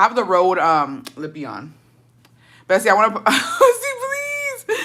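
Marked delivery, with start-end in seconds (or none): 2.7-4.8 laughing while trying to speak. Real, not acted. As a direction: laugh through the words. Laughing at a comment.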